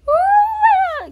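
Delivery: a high, loud whoop of joy; excited whoop. Real, not acted. Winning back health, a high excited whoop. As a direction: cheer it out loud.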